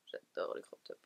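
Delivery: a low whispered echo. Real, not acted. Vlogger whispering her love of crop tops to herself. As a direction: breathe it out to yourself, unvoiced. Repeats line under breath.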